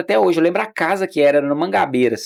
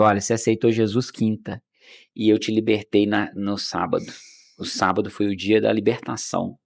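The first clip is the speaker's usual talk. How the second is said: voice of the Holy Spirit; 5 semitones lower, 5 dB quieter than usual. Retold or imitated. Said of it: "o Espírito Santo falou assim comigo".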